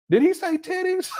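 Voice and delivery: can't believe his ears; incredulous. Real, not acted. Shocked by clip.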